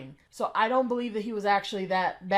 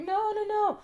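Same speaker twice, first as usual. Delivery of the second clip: high mocking no-no-no; mocking her on-camera refusal, pitched high; mock pleading; 10 semitones higher than usual. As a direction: squeak it out like a panicked girl. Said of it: "she's like".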